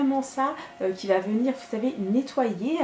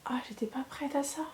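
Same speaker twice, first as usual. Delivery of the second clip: whispered, much softer; shocked whisper; 13 dB quieter than usual. Real, not acted. Vlogger stunned by her foaming skin, soft whisper.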